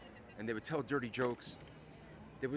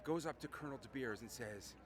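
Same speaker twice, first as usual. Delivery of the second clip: ordinary talk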